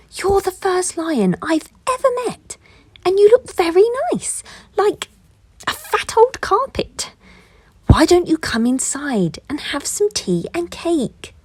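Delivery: high, friendly goose voice; a cheerful high goose inviting the bear in; sweet goose voice. A narrator's reading.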